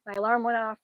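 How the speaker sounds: usual speaking voice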